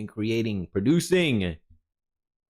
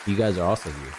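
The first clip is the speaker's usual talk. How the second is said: ordinary delivery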